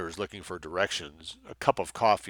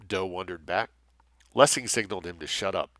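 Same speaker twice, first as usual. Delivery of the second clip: ordinary delivery, nothing marked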